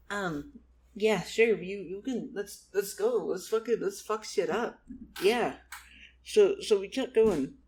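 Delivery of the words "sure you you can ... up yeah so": ordinary delivery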